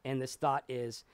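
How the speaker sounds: ordinary talk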